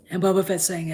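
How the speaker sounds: usual speaking voice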